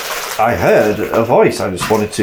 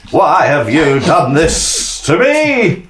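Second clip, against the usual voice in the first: slow, higher, drawn-out plea; anguished plea; 6 semitones higher, much slower than usual. Acted. Pleading with his god at the shrine, drawn-out despair. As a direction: stretch out the despairing question.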